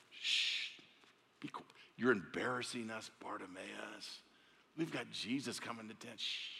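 soft, hushed scolding; hushing. Acted. Crowd hushing blind Bartimaeus, soft hushed scolding. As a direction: shush him down, embarrassed.

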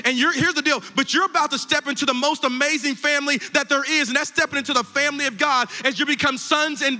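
high, rousing preacher's voice; impassioned preaching. Real, not acted. Pastor addressing those who came forward boldly, impassioned preaching. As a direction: proclaim it to the whole church.

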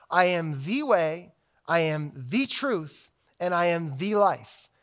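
each "I am the way" said slowly and weighted; slow down so they hear every word; slow, deliberate. Shown as a demonstration. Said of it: "I want you to just to hear the claim".

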